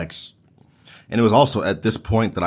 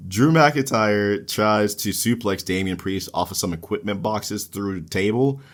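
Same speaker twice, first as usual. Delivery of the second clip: ordinary talk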